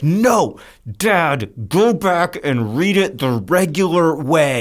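mock complaint from an annoyed daughter; protesting daughter. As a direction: imitate a child pleading with Dad, drawing out the words. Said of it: "she'll be like".

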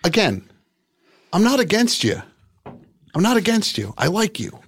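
emphatic. Real, not acted. Host insists he's not hostile.

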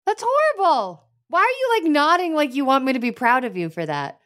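high, incredulous reproach; appalled scolding. Acted. Princess reacting to Tangerine's horrible spell story, appalled. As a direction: scold someone in high-pitched disbelief.

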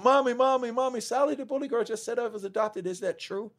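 put-on high, urgent child calling for mommy; a frightened kid begging for the truth; young girl running in to her mother, high and pleading; panicked little girl. Scared girl runs home to mother.

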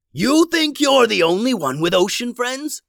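high, loud pirate captain's taunt; villainous yell. A narrator's reading. Pirate captain taunts the heroes while slashing, yelling. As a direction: yell it mid-swordfight. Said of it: "yelled the captain as he hacked".

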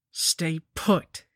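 short, firm command; mildly barked, stern instruction; barked order. A narrator's reading. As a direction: order them sharply to hold still. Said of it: "she barked".

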